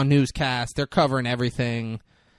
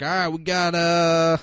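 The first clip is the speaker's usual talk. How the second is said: gruff relay voice; 4 semitones higher, 6 dB louder than usual. Retold or imitated. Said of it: "he's like".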